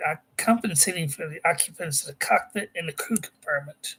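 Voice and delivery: ordinary talk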